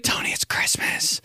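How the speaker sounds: unvoiced hushed wake-up; whispering to a sleeping sister. Waking sister on Christmas.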